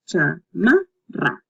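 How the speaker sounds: "chamarra" slowly as cha, ma, rra; syllable by syllable. Shown as a demonstration. Teacher going over it once again, "chamarra" in separate syllables. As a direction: split "chamarra" and trill the double R. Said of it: "keep practicing the double R".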